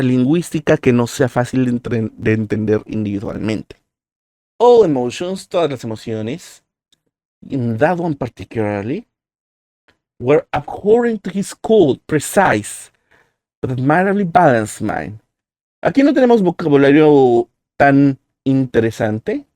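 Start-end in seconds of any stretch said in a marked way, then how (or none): 4.6-15.2 reading aloud. Shown as a demonstration. Reads English text aloud.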